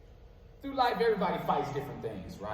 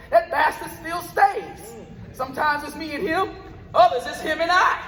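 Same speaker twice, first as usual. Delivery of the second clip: rhythmic, punchy spoken word delivery, words hit on the beat; spoken word poem; 11 semitones higher, 8 dB louder than usual. Acted. Poet performing his piece for a live crowd, rhythmic spoken word. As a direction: perform it like a slam poet on stage.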